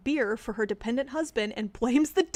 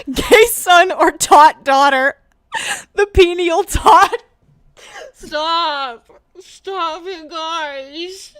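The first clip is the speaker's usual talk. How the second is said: loud, high laughing cries; real laughing fit; crying with laughter; 4 semitones higher, 12 dB louder, much slower than usual. Real, not acted. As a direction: laugh helplessly through the words. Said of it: "It's not my fault".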